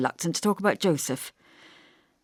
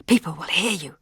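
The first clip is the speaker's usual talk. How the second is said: urgent whisper. Acted. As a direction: hiss a warning under the breath. Perrin hushing her.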